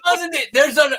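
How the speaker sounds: high, slow, drawn-out repeat; adamant. Retold or imitated. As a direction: hammer it home, high and stretched. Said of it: "adamant, four thousand".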